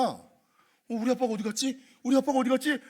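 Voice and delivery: put-on high, bewildered child calling for his dad; lost child. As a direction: play a little boy looking around for his father. Child voiced in a story.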